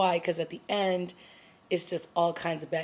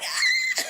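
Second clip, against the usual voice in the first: shocked scream. Retold or imitated. Reacting to the stabbing scene, she gives a high shriek. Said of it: "I'm like".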